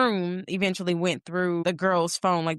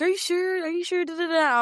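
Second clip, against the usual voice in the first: high mock pushy pleading; pushy date; 10 semitones higher than usual. Mocking the date pressing her to order, high and insistent. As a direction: squeak out a nagging are-you-sure. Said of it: "he was like".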